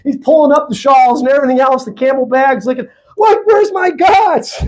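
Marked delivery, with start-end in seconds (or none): 3.2-4.6 high, slow mock shout; furious Laban shout. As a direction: bellow it like a frantic father who lost his idols. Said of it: "furiously going".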